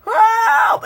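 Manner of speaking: imitated high panicked screams; monster screaming. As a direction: scream for help like a dying crew member. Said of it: "her screaming".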